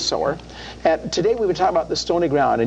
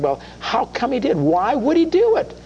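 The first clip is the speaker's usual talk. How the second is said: rising, questioning voice of the doubting mind; doubting inner voice; 11 semitones higher than usual. Retold or imitated. Host voicing the doubting mind to listeners, incredulous. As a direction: let the reasoning mind question it, higher and higher.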